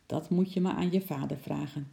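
usual speaking voice

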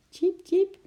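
very high squeaky tjiep; squirrel squeak. A narrator's reading. Little squirrel Eki calls out lonely, high squeaky peep.

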